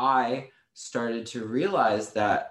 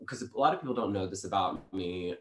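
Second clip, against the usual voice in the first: normal talking voice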